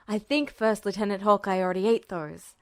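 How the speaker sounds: plain reading voice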